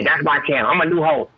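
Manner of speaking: usual speaking voice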